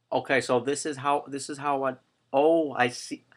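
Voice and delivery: normal talking voice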